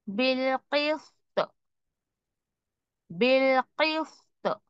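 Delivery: words recited slowly with a bounce on the क़; qalqala recitation. Shown as a demonstration. Teacher reciting qaf examples, slow bounce. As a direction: recite each word, letting the क़ echo. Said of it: "काफ के examples आप देखें".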